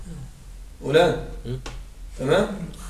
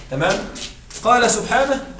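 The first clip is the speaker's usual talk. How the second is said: ordinary talk, nothing marked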